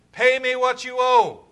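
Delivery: loud, high, drawn-out shout; angry demand. Acted. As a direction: bellow it slowly at the debtor. Creditor seizes debtor, demands payment.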